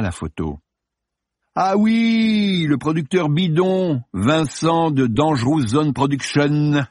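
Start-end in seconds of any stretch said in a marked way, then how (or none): 1.5-6.9 high, drawn-out gleeful mockery; mocking chuckle. A narrator's reading. Actor mocking the phoney producer, chuckling tone. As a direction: relish the ridicule, voice pitched up and stretched. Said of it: "gloussa-t-il".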